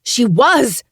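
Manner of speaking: high, loud protest; yelling. Acted. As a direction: shout it back hard. Francesca yells back.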